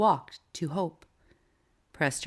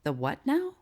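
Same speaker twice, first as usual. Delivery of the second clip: startled; 8 semitones higher than usual. A narrator's reading. Tracy choked on her tea.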